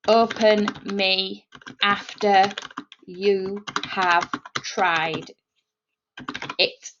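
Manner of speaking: very slow, word by word, low; measured, drawn-out typing-along delivery; slow dictation. Shown as a demonstration. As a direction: spell it out slowly with long gaps between words. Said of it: "That's just how I would speak".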